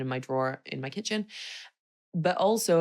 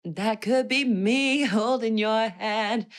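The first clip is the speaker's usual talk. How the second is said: higher, slow, teary laughing; retold tearful gush; 5 semitones higher, 3 dB louder, slower than usual. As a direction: mimic crying with a laugh. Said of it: "I obviously broke down in tears".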